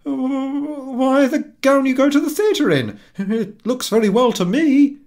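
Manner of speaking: very high, loud, flustered husband voice; stammering husband. A narrator's reading. Husband caught without an answer, stammering high and loud. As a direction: blurt it out, caught off guard. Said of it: "He stammered".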